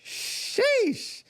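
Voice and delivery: high, stretched "Sheesh"; be blown away, squeal it out; impressed exclamation. Real, not acted. Reacting to eighteen UFC fights.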